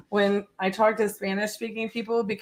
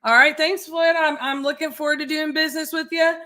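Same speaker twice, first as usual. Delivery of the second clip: higher-pitched Southern sign-off to a client; Southern accent; 7 semitones higher, 3 dB louder than usual. Real, not acted. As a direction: wrap up the call in a lilting Southern accent. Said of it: "you mirror the thick Southern accent".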